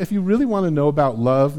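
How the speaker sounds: normal talking voice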